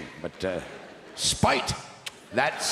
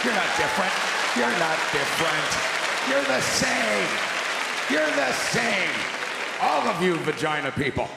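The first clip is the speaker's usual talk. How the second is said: mock-idiot protest, raised pitch; idiot man; 4 semitones higher than usual. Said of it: "they always use that idiot voice".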